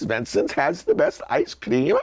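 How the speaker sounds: slow Swedish slogan with a little bounce; bouncy Swedish accent. Acted. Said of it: "with the little bounce".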